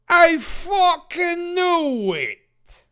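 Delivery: furious shout. Acted. Dave yelling at the unmasked ghosts, very slow. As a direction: bellow it like a cartoon villain.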